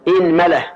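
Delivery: إنملة said with i on the hamza, inmula; إنملة opening on a short i, inmula; inmula with kasra. Shown as a demonstration. Teacher shows the kasra form of the hamza, i-nmula. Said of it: "كسر الهمزة وفتح الميم".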